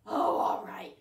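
plain reading voice

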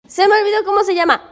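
high, loud, rushed; alarmed cry. Real, not acted. Vlogger bothered by her dog mid-explanation, alarmed cry. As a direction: blurt it out fast and high.

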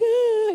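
high, drawn-out mock wail; high retold cry of pain. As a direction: wail like a hurt kid, hammed up. Imitates herself crying on the ground.

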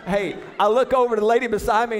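ordinary talk

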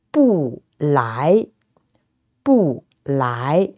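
"不" falls, then "来" rises; fourth-tone bu. Shown as a demonstration. Teacher giving a phrase to repeat, "不" falling, "来" rising. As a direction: drop "不", then lift "来" from low. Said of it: "bu, with the fourth tone".

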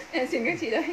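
words through laughter; begging while giggling; laughing. Real, not acted. (vừa nói vừa cười)

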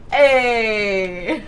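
playful Italian "Hey!", long and falling. Pretend. Gamer showing off her Italian coming out, mock Italian. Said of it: "Now my Italian is coming out".

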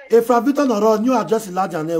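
loud, high name call. Real, not acted. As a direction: call the name across the room. Calling out fan name.

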